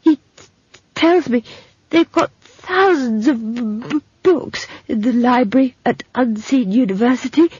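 high stammer full of adoration for books; halting and rapt, sticking on consonants; stammering, in love. Acted. Simon telling Esk of the library, lovestruck stammer. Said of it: "in the voice of a man in love".

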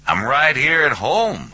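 high voice through a speakerphone. A narrator's reading. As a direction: answer easily down a tinny phone line. Hubble's voice over the speakerphone.

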